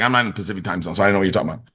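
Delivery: lightly mocking an audience member who missed the time zone; confused caller. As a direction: play a low, muddled complainer. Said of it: "I know someone's like".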